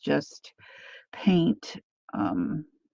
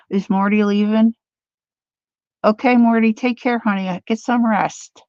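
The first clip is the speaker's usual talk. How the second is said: higher, louder call to a friend leaving; 5 semitones higher, 6 dB louder than usual. Real, not acted. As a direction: call warmly across the room. Calling out goodbye to Marty.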